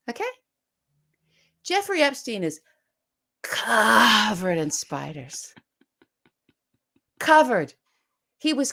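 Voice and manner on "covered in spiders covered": slow dramatic. Acted. Punchline repeated slowly.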